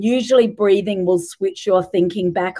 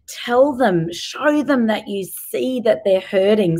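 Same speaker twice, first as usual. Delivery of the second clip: ordinary delivery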